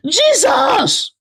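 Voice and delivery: high shocked scream of Jesus. Acted. Woman in the crowd hears the confession, shocked scream. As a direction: shriek it out in shock. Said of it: "one of the women screamed".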